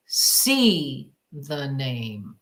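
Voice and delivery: low, very slow, drawn-out voice; a low voice speaking deliberately into her ear; mysterious inner voice. Retold or imitated. Voice in her left ear telling her to see the name. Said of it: "And so he said".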